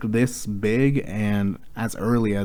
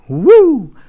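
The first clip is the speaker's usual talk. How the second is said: excited whoop; 13 dB louder than usual. Retold or imitated. Mock developers cheering.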